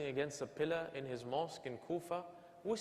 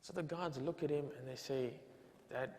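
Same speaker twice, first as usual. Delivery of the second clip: normal talking voice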